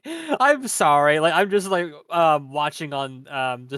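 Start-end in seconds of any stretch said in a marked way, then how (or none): 0.0-1.2 high, slow apologetic "sorry"; high pitched sorry. Retold or imitated. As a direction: stretch out a squeaky apology. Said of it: "He's like".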